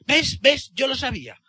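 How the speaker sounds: high, excited "told you so"; triumphant child. Retold or imitated. Niece to her uncle after his spelling answer, triumphant. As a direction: imitate a child crowing, pitch jumping up high.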